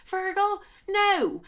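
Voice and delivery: high, sharp, exasperated; outraged no; indignant. Real, not acted. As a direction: spit his name out in disbelief. Ranting at Fergal.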